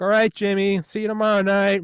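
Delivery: casual handler. Retold or imitated. Handlers dismissing Fallon.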